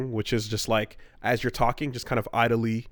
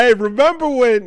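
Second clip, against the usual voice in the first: higher, louder hammed-up mob guy; playful gangster impression; mock Tony Soprano; 12 semitones higher, 7 dB louder than usual. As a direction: call it out like a bragging mobster.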